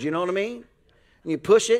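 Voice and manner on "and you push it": normal talking voice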